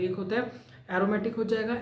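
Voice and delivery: ordinary talk, nothing marked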